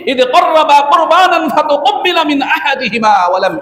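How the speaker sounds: high, slow, drawn-out Arabic recitation; Quranic recitation. Real, not acted. Preacher quoting God's words to the congregation, Quranic recitation. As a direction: recite the verse with stretched vowels.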